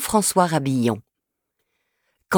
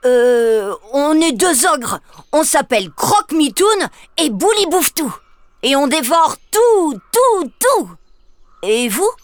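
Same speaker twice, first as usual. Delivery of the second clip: higher, hesitant little ogre; sheepish ogre; 7 semitones higher than usual. Acted. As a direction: explain yourself a bit awkwardly. L'ogre répond à la fillette. (petit ogre hésitant, voix plus aiguë)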